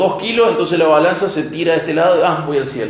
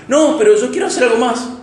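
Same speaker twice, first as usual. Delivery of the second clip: whiny objection; 7 semitones higher than usual. Acted. Person objecting to Christ's call.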